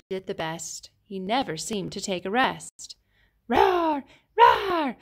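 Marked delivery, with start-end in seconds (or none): 3.5-5.0 loud shouted mock roar; dinosaur roar. Allosaur roaring at stones, loud playful roar.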